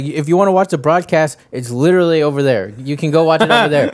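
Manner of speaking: mad streamer. Retold or imitated. Streamer telling chat where the broadcast is, mock mad. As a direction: imitate a streamer losing patience. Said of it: "one of the times he got mad".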